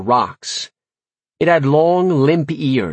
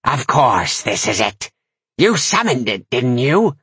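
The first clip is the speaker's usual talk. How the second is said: a hint of a scowling crone; scowling witch. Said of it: "She scowled".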